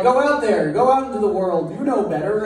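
a hint of a cheery manager; breezy boss send-off. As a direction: wave the new hire out the door. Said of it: "they just were like".

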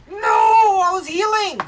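loud high cry of protest; anguished shout. Real, not acted. Gamer screaming at the screen as the character dies. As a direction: yell it as the kill lands. Dies in game mid-heal.

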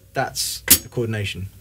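ordinary talk, nothing marked

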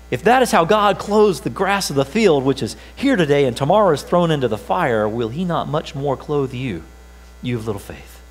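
ordinary talk

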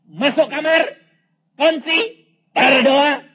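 loud, high preacher's proclamation; God's command. Retold or imitated. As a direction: proclaim each command forcefully, with pauses between. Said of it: "Hari ketiga Tuhan berkata".

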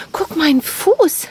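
high, slow and drawn out. Real, not acted. Zeigt Kind Sandfuß. (langsame, hohe Babysprache)

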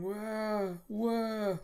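high, drawn-out mock crying; acted high "wah" cries; imitated baby wail. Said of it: "you hear the big one".

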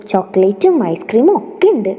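swooping pitch on each treat; delighted child. Acted. Little girl telling her sister about treats, delighted. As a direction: list the treats with childlike glee.

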